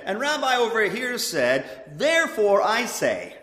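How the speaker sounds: climactic rabbi pronouncement. Retold or imitated. Preacher mimicking a rabbi to the congregation, loud and rising. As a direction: build it to a proud close.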